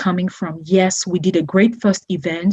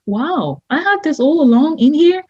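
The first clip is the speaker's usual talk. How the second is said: higher pitched, wide-eyed wonder; imitating her own amazement, pitched up; amazed; 7 semitones higher than usual. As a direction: retell the discovery with delighted surprise. Amazed at hidden treasures, retold.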